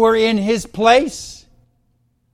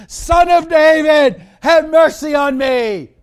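loud, high pleading shout; crying out even louder; 8 semitones higher, 6 dB louder than usual. Acted. Bartimaeus crying out even louder for Jesus to hear. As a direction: belt out the plea, much higher. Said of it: "he cried out even louder".

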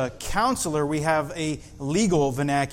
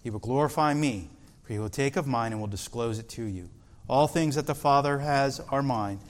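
softer, measured Bible reading voice; scripture reading; 5 semitones lower, 6 dB quieter than usual. Acted. Pastor reading the Gospel to the congregation, soft and measured. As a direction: read the verses with quiet reverence.

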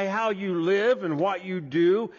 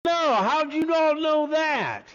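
put-on Southern accent; 7 semitones higher, 3 dB louder than usual. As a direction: play a surprised visitor with a Southern accent. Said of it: "you're from down south".

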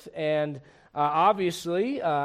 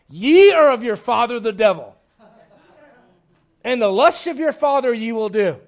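loud scriptural rebuke; 9 dB louder than usual. Acted. Preacher shows how he would not address a lost person, loud rebuke. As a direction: thunder it as an accusation.

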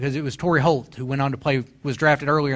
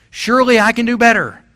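higher and louder, preaching it out; preached aloud; 8 semitones higher, 8 dB louder than usual. Shown as a demonstration. Preacher urging himself on, higher and louder. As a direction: proclaim it like a sermon line. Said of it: "And I say that to myself".

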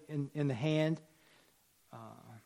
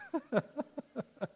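light, higher-pitched chuckle; 9 semitones higher, 5 dB quieter than usual. Real, not acted. Preacher thanking a listener for help, amused chuckle. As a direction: laugh it off lightly. Laughs after being helped out.

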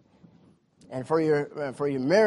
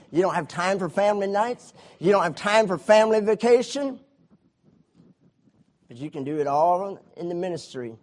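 ordinary delivery

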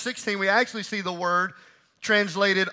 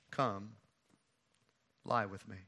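soft, low invitation; quiet seduction; 9 semitones lower, 12 dB quieter than usual. Retold or imitated. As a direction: murmur it low and close. Amnon makes his move quietly.

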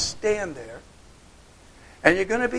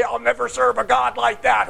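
mock defiance; 4 semitones higher, 6 dB louder than usual. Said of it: "what kind of madness is it to say".

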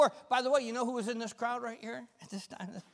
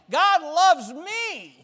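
higher, louder and slow, leading the crowd; loud call; 9 semitones higher, 13 dB louder, much slower than usual. Shown as a demonstration. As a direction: call it out for them to echo. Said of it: "Let's try this again".

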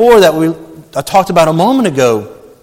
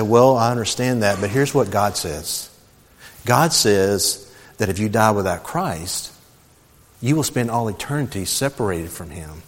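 soft, low and unhurried; calm, even tone; 6 semitones lower, 9 dB quieter than usual. Retold or imitated. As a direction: answer an angry man gently, without raising your voice. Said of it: "I keep talking like this tone".

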